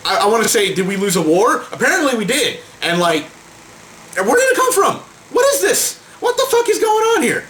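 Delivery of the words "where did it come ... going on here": disbelieving outburst. Real, not acted. Ranting at YouTube's changes, high exasperated disbelief. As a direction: throw up your hands in disbelief.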